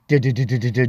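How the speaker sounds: low, rapid du-du drum roll; vocal drum roll to build suspense; imitated drum roll. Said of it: "drum roll".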